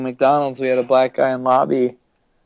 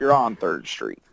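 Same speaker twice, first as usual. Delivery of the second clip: low, slow, softer reply; police officer; 8 semitones lower, 7 dB quieter, slower than usual. Acted. Officer answering a lost driver, low slow voice. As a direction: drop low and slow as a mock cop.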